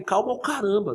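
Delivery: high, sharp retort; answering "calma" with a sharp high retort; heated retort. Retold or imitated. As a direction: snap back, pitched high. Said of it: "Não falei, "Calma o caramba", né?".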